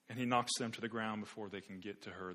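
ordinary talk

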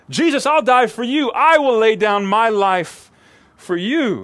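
loud, high boast of a proud disciple; boastful Peter. Acted. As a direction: proclaim it like you're sure you're the hero. Peter's boast, mocked by preacher.

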